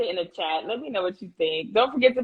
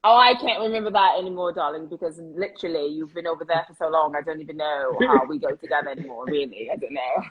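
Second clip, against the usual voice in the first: put-on British accent, lower in pitch; 6 semitones lower than usual. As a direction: play it British, darling, dropping lower as you go. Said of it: "she was really British".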